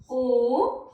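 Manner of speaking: slow, drawn-out vowel recitation for pupils; vowel chart read aloud for children; reciting vowels. Shown as a demonstration. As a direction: sound out each vowel clearly with long pauses. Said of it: "जैसे मैं उच्चारण कर रही हूँ".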